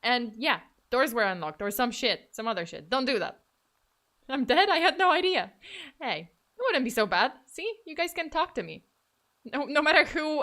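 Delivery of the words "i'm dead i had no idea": raised, loud feigned disbelief; mock surprise. Streamer pretending to learn she is a ghost, mock surprise. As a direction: act astonished at the news.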